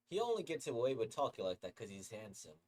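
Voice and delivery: usual speaking voice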